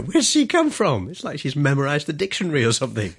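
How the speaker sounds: a stunned opponent asking where she came from; baffled Scrabble player. Retold or imitated. London Scrabble players amazed at the newcomer, high and baffled.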